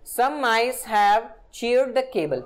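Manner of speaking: very slow, each word set apart; slow example read. Shown as a demonstration. Teacher reading an example sentence to the class, very slow.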